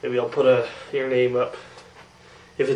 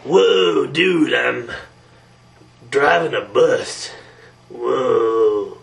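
low, slow cartoon dude voice; laid-back stoner bus driver impression; spaced-out bus driver; 6 semitones lower, much slower than usual. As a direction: drop low, dazed dude at the wheel. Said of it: "do an impression video of all my".

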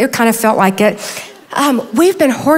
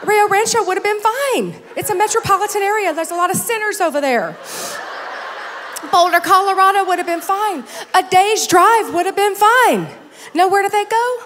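mock-tearful protest; 8 semitones higher than usual. Mother objects to son moving far away, mock wailing. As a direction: plead and protest, pitched way up.

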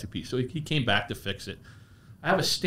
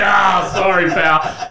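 mock shout; 7 semitones higher, 10 dB louder, much slower than usual. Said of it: "He was like".

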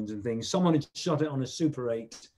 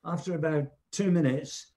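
ordinary talk, nothing marked

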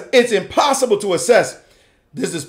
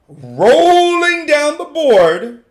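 "rolling" stretched long, high and loud; slow, showy stretch on "rolling"; drawn-out boast; 6 semitones higher, 4 dB louder, much slower than usual. Acted. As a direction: swell up on "rolling" and hold it. Voices player boasting of passed pawns.

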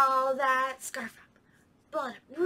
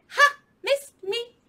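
high, loud singsong taunt; teasing monkey; 12 semitones higher, 7 dB louder than usual. Acted. Monkey teasing the alligator, high playful taunt. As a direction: taunt like a cheeky little monkey.

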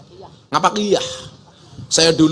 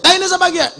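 higher, louder yell in ngapak; ngapak man yelling; 5 dB louder, faster than usual. Retold or imitated. Ngapak man stepping in dung yells, higher and louder. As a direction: call out in disgust after stepping in it. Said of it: "Orang ngapak teriak".

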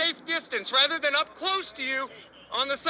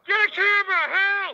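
high, loud desperate call; shouting for help; 4 semitones higher, 4 dB louder than usual. Real, not acted. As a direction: yell to bystanders. Calling to bystanders.

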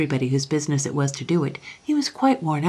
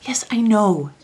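louder, higher cut-in; impatient interruption; 5 semitones higher, 6 dB louder than usual. A narrator's reading. Young king interrupts the Chancellor's list of greats, impatiently. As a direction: break in on a long-winded speaker. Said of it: "Lionel interrupted".

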